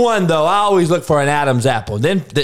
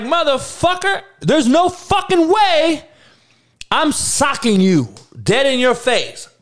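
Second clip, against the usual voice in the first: much higher, outraged threat at the door; imagined outraged yell; 8 semitones higher than usual. Retold or imitated. Shocked prom date at the door, outraged mock threat. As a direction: blow up at the date, pitched way up.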